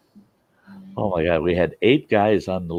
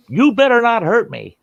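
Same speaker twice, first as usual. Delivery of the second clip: high, loud warning; mock threat; 7 dB louder than usual. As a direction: imitate the woman snapping a warning. Trapped woman threatening rescuers.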